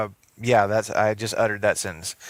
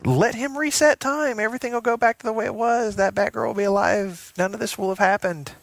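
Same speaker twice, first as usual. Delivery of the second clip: pitched way up, pleading; high pleading voice; 10 semitones higher than usual. Retold or imitated. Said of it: "And here I am like".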